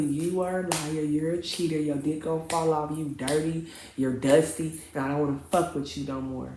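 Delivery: low, soft, deadpan accusations; restrained telling-off. Retold or imitated. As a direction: list the insults quietly and firmly. Acted final telling-off at the car.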